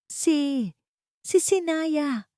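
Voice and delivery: put-on very high, slow, halting old woman's voice; name like a curse. A narrator's reading. Dying mother names the daughter-in-law, slow and high. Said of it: "na para bang ang pangalan ay isang sumpa".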